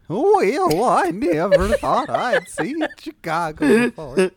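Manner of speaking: high, hammed-up old cartoon hound; cartoon dog voice. Podcaster jokes about Chicago, high cartoon dog voice. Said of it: "It's the dog from Lady ... from Fox and the Hound".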